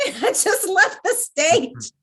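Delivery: laughing hard, voice breaking up high. Real, not acted. Telling the podcast hosts about the flub, breaking into laughter. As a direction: crack up while telling it.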